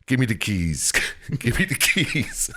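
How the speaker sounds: mock Russian accent; lightly hammed-up Russian accent; Russian army guy. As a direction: demand it like a Russian soldier, half laughing. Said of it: "some weird Russian army dude's".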